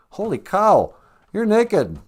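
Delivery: high, loud startled outburst; shocked cry. Acted. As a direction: gasp it out in shock. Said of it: "it's like".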